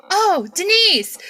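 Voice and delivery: high, loud, drawn-out cry of recognition; excited greeting. Retold or imitated. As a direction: call out to a friend you've just spotted. Acted greeting of friend at escalators.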